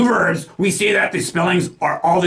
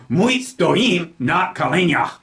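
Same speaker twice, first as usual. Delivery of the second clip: "stoim" stressed on its second syllable, slowly; slow model sentence, punching the end of "stoim"; slower than usual. Shown as a demonstration. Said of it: "Remembering to put the stress on the second syllable".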